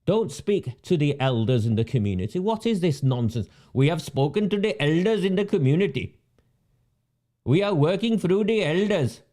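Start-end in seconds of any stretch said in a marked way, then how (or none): 3.7-9.2 mocking recital of a stock statement; mocking official. Ridiculing officials who speak only to elders, mocking parroting. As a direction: parrot it in a raised, mock-official voice.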